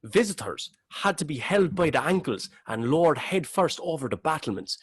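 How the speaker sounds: put-on Cork accent. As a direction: read the brochure like a Cork tour guide. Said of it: "I'm gonna do it in a Cork accent".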